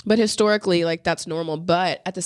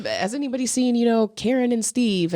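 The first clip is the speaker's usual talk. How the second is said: mock curious asking; 6 semitones higher than usual. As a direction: ask after missing friends, pitched up. Repeats the acted question with new names.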